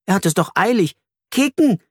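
put-on high, urgent child's plea; impatient child. A narrator's reading. Flori pulls at the teacher's hand, urgent and eager. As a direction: tug to get out and play. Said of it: "er zerrt an Antjes Hand".

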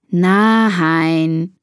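drawn-out exasperated groan. A narrator's reading. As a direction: groan it like a put-upon sister. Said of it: "stöhnt Bea".

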